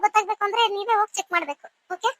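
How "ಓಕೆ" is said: ordinary talk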